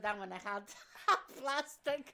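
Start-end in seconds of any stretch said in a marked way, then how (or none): 0.7-1.4 high burst of laughter; big squealing laugh; loud laugh. Real, not acted. Laughing at plastic couch story.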